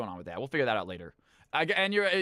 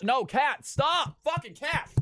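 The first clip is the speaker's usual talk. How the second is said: sharp, high-pitched yell at a cat on the keyboard; 10 semitones higher, much slower than usual. Real, not acted. Streamer scolding his cat walking on the keyboard, high exasperated yell. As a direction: snap at the cat, pitch jumping way up. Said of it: "I know I screamed at my cat".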